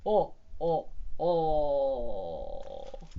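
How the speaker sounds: acted booming belly laugh; big pretend ho-ho laugh; roaring laughter. A narrator's reading. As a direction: laugh like a jolly snowman. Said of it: "It roared with laughter".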